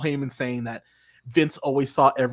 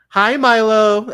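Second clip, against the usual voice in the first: bright, high, louder hello to someone off mic; high greeting; 8 semitones higher, 9 dB louder, slower than usual. Real, not acted. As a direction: sing out a quick hi.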